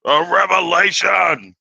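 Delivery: high, slow announcement of a revelation; drawn-out proclamation. Acted. Opening the video to viewers, drawn-out proclamation. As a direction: stretch it like a big reveal.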